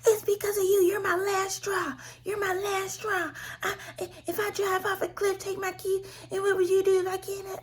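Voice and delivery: high, wailing imitation of a crying mother; mock crying mother. Imitating her mother's crying accusations, high and wailing. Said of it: "she spazzing".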